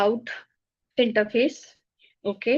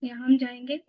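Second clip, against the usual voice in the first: ordinary talk, nothing marked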